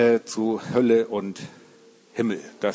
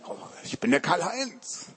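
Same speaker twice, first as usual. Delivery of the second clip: parrot voice; 5 semitones higher, 5 dB quieter than usual. Acted. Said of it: "dann sagt der Papagei".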